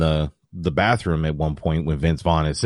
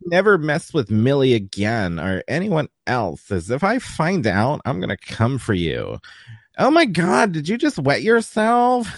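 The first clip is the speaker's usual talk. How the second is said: high-pitched hammed-up girl voice, rising to a squeal; Millie; 9 semitones higher than usual. Girl in a man's body warns off a bully, hammed-up girly threat. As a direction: play a teenage girl threatening, then appalled. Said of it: "I don't kinda remember that voice".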